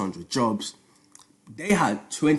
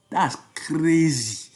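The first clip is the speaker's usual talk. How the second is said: amazed, higher Nigerian-accented exclamation; 3 semitones higher than usual. Real, not acted. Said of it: "Nigerian accent came out there".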